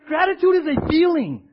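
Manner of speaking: high, loud declaration; emphatic preaching. Real, not acted. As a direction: proclaim it with fired-up conviction. Preacher insisting on feeling.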